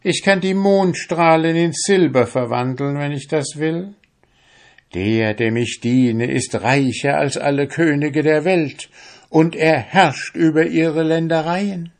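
a hint of enticing witch; boasting witch. Witch boasting of her magic to the fisherman, lightly enticing.

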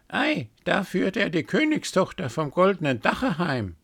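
a gossiping bird sneering; cawing raven voice. A narrator's reading. First raven to the others, high mocking caw.

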